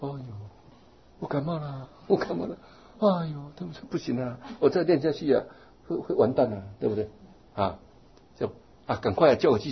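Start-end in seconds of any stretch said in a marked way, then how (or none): 0.0-7.1 higher, whining mock sick voice; mock whiny complainer. Monk mocks a middle-aged person whining about a cold, higher whine. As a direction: ham up a feeble excuse. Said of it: "要是一般人呢".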